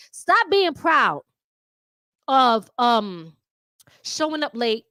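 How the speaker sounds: drawn out word by word; slow down to make the point land; deliberate, emphatic pace. Real, not acted. Lecturing viewers, slow emphasis.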